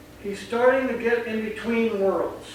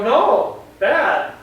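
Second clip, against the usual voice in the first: firm scolding; 4 semitones higher, 6 dB louder than usual. Shown as a demonstration. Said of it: "You're gonna go".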